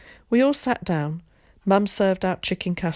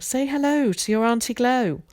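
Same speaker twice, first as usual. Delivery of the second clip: lightly raised mother's prompt; voiced mum; 7 semitones higher than usual. A narrator's reading. Mum nudging Ted to greet his aunt, lightly voiced. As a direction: coax him gently like a mum.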